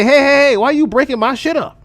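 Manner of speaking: high, loud confronting yell; angry manager shout. Retold or imitated. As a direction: storm into the room yelling at the guest. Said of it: "and say".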